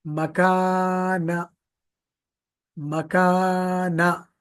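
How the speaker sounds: मकाना with both long आ held out, start and end kept short; stretched long vowels. Shown as a demonstration. Teacher showing which letters to draw out. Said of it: "उसको हम खींचना है".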